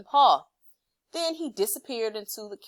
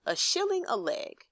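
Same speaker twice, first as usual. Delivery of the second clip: high, pondering tone; thoughtful mock calculation; 11 semitones higher than usual. A narrator's reading. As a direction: muse it over like doing sums. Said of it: "at last he said".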